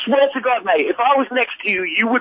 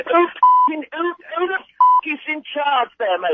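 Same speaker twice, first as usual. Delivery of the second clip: angry rant; 7 semitones higher than usual. Real, not acted. Angry prank call victim.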